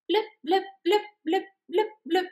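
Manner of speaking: high beeping blips; computer blips. Acted. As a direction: blip like a computer screen. Computer sound effect.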